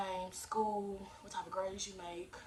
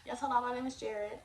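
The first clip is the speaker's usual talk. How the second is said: lightly put-on phone voice, pitched up; a touch of customer service polish; 5 semitones higher, 4 dB louder than usual. Shown as a demonstration. Teen applicant introducing himself by phone, light customer service voice. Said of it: "put your customer service voice on".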